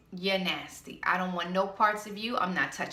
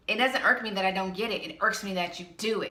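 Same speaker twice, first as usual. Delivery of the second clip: ordinary talk, nothing marked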